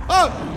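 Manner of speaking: sudden high yelp; startled yelp. Real, not acted. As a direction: jump and yelp in surprise. Startled cry.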